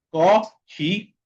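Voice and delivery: "Corrigir" in separated syllables, middle one starting like Spanish jota. Shown as a demonstration. Teacher breaking down double R, syllable-by-syllable demo. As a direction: break "Corrigir" slowly into co, rri, gir, RR as J. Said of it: "por eso que yo digo corrigir".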